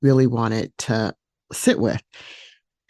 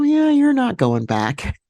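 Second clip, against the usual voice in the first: mock parent voice, pitched much higher, a light laugh inside; parents teasing; 5 semitones higher than usual. Parents teasing her about staying.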